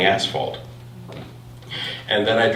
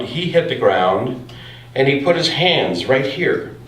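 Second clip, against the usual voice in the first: normal talking voice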